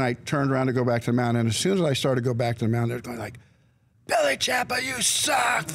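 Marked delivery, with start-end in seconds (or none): 4.1-5.7 high-pitched jeering shout; crowd heckle. Retold or imitated. Yankee fans jeering the pitcher walking back, high mocking shout. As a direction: yell it like a hostile ballpark heckler.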